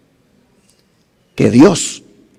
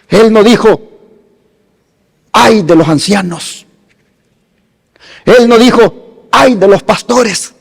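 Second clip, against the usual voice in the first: building emphasis; 7 semitones higher than usual. Acted. Rhetorical list building up.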